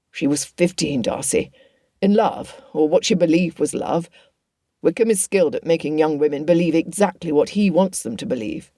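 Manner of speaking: plain reading voice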